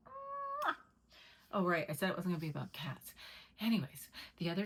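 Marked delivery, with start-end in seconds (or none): 0.1-0.7 kiss. Real, not acted. Kissing the dog.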